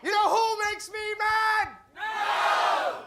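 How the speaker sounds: high, loud, slow shout; comic and crowd shouting the call and answer; angry rant. Acted. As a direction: bellow it like a ranting comic. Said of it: "Let's do it again".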